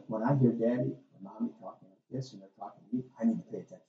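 put-on low, hushed voice of a trained child; calm, quiet talk. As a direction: think it through softly and low, as the obedient kid. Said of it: "You talk in a very calm".

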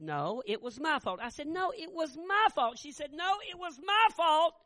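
fervent pulpit shouting; preaching at full force. Acted. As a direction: preach it like a revival. Retold argument preached at full volume.

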